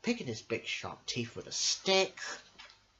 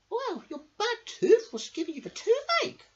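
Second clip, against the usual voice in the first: high, squeaky mouse voice; tiny helpful mouse; 6 dB louder than usual. Acted. Mouse explaining the toothache to the crocodile, squeaky. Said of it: "answered the mouse".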